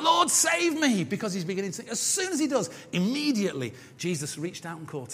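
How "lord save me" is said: high, drawn-out plea to be saved; crying out for rescue. Acted. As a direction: cry out like a sinking man. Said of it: "as soon as Peter cries out".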